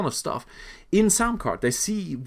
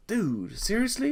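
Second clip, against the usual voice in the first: high disbelieving "seriously?"; dude, are you kidding me; incredulous; 9 semitones higher than usual. Retold or imitated. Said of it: "I'm going".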